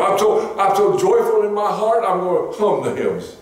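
high, hammed-up joy; mock joyful claim. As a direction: oversell the joy. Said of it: "I just tell him".

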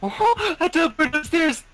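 high, loud laughing outburst; excited squeal. Real, not acted. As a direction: squeal with delighted disbelief. Sudden teleport in laggy game.

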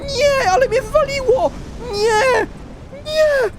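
very high drawn-out wailing; anguished wail. Real, not acted. Player knocked out so close to the end, anguished high wail. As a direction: wail in despair, pitch through the roof.

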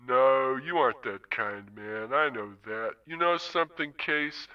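Zone, easy and unhurried, a hint of menace; languid pimp. Wintermute as Zone needling Case about the flatline, lightly voiced languid pimp.

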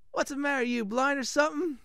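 indignant mock-Italian, pitched high; high, hammed-up Italian blacksmith; bad Italian accent. Blacksmith scolding a customer, bad Italian accent. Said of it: "this is gonna be very bad Italian accent".

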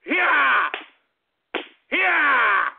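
drawn-out falling "yeah" with each lash; pretend whipping yell. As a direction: yell as you swing the belt. Pretends to whip with belt.

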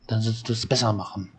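quick, rushed repeat; fast reading. Acted. Game elder repeating the boat advice in a quick rush. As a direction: rattle the line off fast. Same line read twice, slow then fast.

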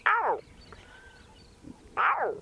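high, slow lion cub mew; lion cub impression. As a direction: mimic a lion cub calling. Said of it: "my lion cub impression".

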